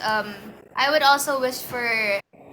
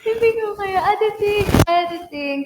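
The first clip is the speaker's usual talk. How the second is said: laughing; 10 semitones higher, 6 dB louder than usual. Real, not acted. Laughing after genie bit.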